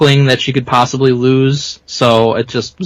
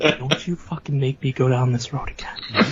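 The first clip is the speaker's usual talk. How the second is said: mock whisper fight; 8 dB quieter than usual. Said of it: "rave reviews for our whisper fight".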